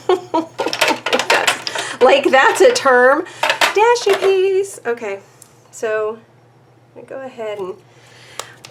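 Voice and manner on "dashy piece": Dashy piece sung out high and slow; playful high dashy piece. Shown as a demonstration. Crafter showing off her own term, high and slow. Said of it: "Don't you love my terms".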